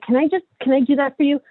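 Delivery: a hint of an eager teen offering help; imitating her son. Mother imitating her son's offer of help, light teen voice. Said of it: "and my son's like".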